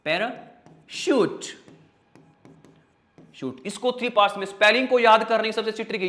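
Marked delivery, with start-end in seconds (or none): 0.1-3.6 पैराशूट stretched slowly, para then shoot; slow syllable reading. Shown as a demonstration. Teacher breaks पैराशूट into parts for pupils. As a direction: sound out पैराशूट part by part. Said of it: "इसको ध्यान से पढ़ना".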